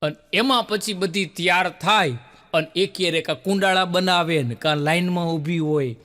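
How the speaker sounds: ordinary talk, nothing marked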